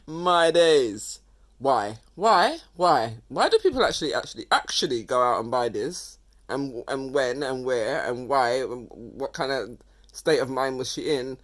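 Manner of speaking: very loud, baffled questioning; loud, exasperated ranting; exasperated disbelief. Real, not acted. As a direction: demand an explanation at full volume. Still ranting at the outfit.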